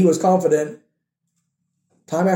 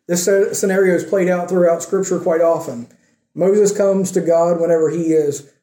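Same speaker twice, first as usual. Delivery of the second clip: ordinary talk, nothing marked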